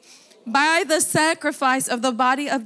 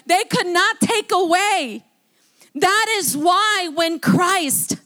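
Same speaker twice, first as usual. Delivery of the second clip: raised, higher, slower pulpit delivery; fervent preaching; 5 semitones higher, 4 dB louder than usual. Acted. Pastor preaching Hebrews to the congregation, fervent and raised. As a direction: preach it out with full conviction.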